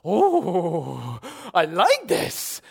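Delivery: drawn-out, savouring ooh; slow, loud relish on first reading; delighted reaction. Acted. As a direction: act out delighted discovery, stretching the words. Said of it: "it's just like".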